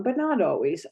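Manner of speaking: ordinary talk, nothing marked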